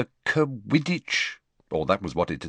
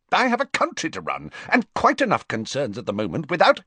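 fast, higher, exasperated retort; irritated snap; 8 semitones higher, faster than usual. Acted. The Prime Minister snaps at Fudge, fast and exasperated. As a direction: rattle it off, fed up.

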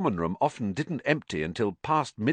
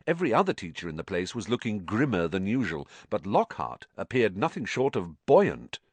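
ordinary delivery

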